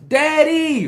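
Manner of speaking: high, loud gleeful "Daddy!"; excited child shout. Retold or imitated. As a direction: squeal it like a little girl seeing her dad. Daughter running to dad.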